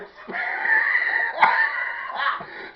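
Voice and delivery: very high squealing laugh; squealed cackle; high shrieking laugh. Real, not acted. Laughing at own story.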